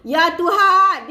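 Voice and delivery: loud, slow, drawn-out showy amazement; borrowed catchphrase exclamation. Shown as a demonstration. Repeating a teacher's phrase for followers, exclaiming. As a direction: stretch the last word out, loudly. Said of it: "saya guna ayat Teacher Zura Rahman".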